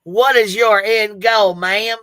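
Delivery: loud, slow mock demand; drawn-out imitated disbelief; mock incredulous challenge. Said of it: "I should have said".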